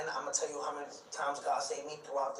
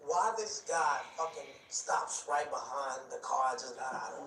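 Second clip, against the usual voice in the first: ordinary talk, nothing marked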